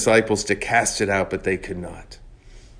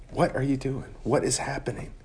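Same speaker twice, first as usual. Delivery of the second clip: soft, searching questions; gentle questioning; 4 semitones higher, 7 dB quieter than usual. Acted. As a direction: ask it quietly, drawing them out. Jesus's questions voiced softly.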